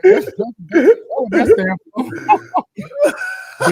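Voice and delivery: ordinary talk, nothing marked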